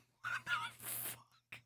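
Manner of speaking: slow whispered disbelief; stunned whisper. Real, not acted. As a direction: breathe it out, aghast. Stunned at the post.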